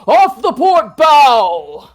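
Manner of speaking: put-on high, slow, loud old pirate shout; mock an old sea dog. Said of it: "mockery of a very old-sounding pirate".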